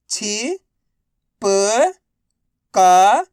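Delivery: छि, प, का each alone, held and rising; letter-by-letter drill. Shown as a demonstration. Teacher for students to repeat, छि, प, का drawn out singly. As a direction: sound out छि, प, का with long gaps between. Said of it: "एक अक्षर का उच्चारण".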